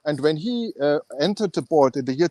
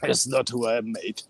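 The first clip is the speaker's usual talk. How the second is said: low, half-hearted Australian accent; attempted Australian accent; 5 semitones lower, 4 dB quieter than usual. Shown as a demonstration. Guest trying Australian for the podcast host, half-hearted Australian accent. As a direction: have a reluctant go at Australian, dropping low on "mate". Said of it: "can you do an Australian accent".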